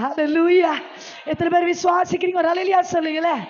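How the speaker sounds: loud, fervent, fast call to the crowd; shouted hallelujah call. Acted. Preacher urging the congregation to answer with a hallelujah, loud shout. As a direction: rouse the church to shout back.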